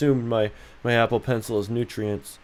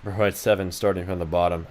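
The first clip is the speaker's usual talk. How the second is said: ordinary talk, nothing marked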